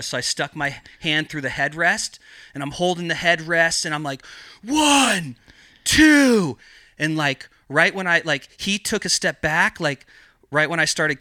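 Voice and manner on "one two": loud, high-pitched threatening count; shouted countdown. Retold or imitated. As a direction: call out each number like a warning to the whole street. Acts out his shouted countdown threat.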